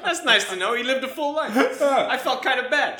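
high pitched laughter; laughing hard. Real, not acted. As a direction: talk through the laughter. Laughing at character's death.

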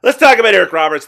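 bursts in loud and quick to kick off the segment; energetic host intro. Real, not acted. Host launching the Eric Roberts segment, loud and quick.

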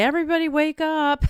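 loud, high excited call. Retold or imitated. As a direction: wake the whole house. Abby's Christmas morning call.

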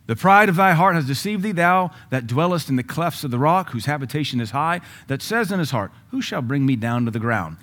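loud, high preaching of the verse; scripture at full force. Real, not acted. Preacher proclaiming Obadiah to the church, at full force. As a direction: thunder the verse out over the congregation.

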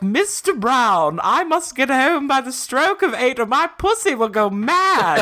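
put-on high, slow posh old lady voice; a prim British lady on the phone; Mrs Slocum. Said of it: "She's like".

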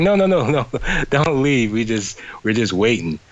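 high, laughing no no no; laughing plea. Retold or imitated. Fans laughingly begging [name] not to leave, high pleading. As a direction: beg him to stay through giggles.

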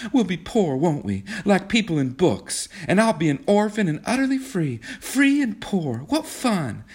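put-on high, girlish glee; gleeful, high-pitched daydreaming; childish delight. A narrator's reading. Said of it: "in a sort of childish delight".

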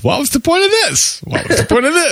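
high, whiny what was the point; exasperated repeat. Retold or imitated. Cohost acting out her fed-up note, exasperated. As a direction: moan it like you've given up on the episode. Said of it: "my one note five times".

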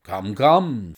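loud, hearty brush-off; jolly the listener along briskly in manner; bustling, bluff visitor. Acted. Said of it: "said he in his bustling way".

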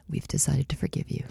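breathy leaning-in whisper. Pretend. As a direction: whisper it right in their ear. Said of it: "the mom leans in".

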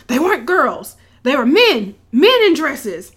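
loud, emphatic retold outburst; worked-up mock protest; hysterical. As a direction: insist it loudly, imitating a hysterical man. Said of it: "hysterical running off".

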